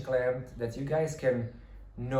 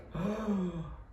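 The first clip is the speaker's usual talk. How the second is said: sharp gasp; 7 semitones higher, 4 dB quieter than usual. Retold or imitated. Reacting to the castle.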